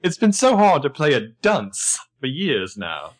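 hammed-up British accent, stretched out; Toby. Joking that Toby was secretly British, mock British accent. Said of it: "what if Toby starts speaking British".

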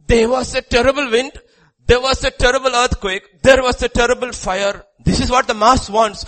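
high, loud, hammering repetition; full-force preaching. Real, not acted. Preacher shows what the mass wants, full-force preaching. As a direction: drive each disaster home at full volume.

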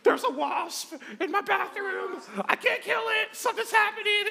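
shrill mock panic of a scared girlfriend; panicked girlfriend. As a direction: mimic her freaking out in a high shriek. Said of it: "She's like".

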